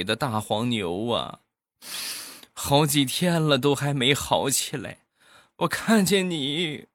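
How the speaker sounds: a hint of a weepy country auntie; sad old auntie. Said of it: "很伤心的就说".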